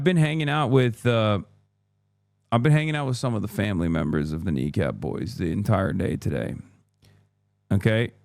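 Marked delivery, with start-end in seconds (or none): none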